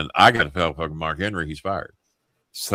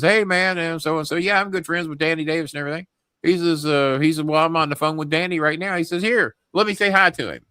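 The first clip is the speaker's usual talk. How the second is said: higher-pitched retold voice of a chummy acquaintance; chummy name-dropper; 9 semitones higher than usual. Former partner boasting to Mark that he knows Danny, chummy name-dropper. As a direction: lightly imitate an eager, friendly caller.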